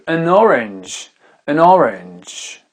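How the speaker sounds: "an orange" said slowly, linking an into orange; drawn out like a teacher; slow English model. Shown as a demonstration. Teacher to French learners, slow careful English. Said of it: "Une orange".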